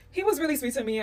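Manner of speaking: usual speaking voice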